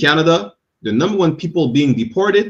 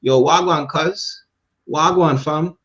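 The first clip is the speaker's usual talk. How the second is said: mock Jamaican greeting voice, higher; Jamaican accent; 3 semitones higher, slower than usual. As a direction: imitate a wannabe Jamaican saying hello. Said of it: "he's gonna be like".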